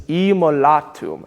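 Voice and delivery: immolatum with the weight on la; stress on la. Shown as a demonstration. Director repeats the model word for choir, stress on la. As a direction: lean on the la in immolatum, im-mo-LA-tum. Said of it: "Emphasis on la".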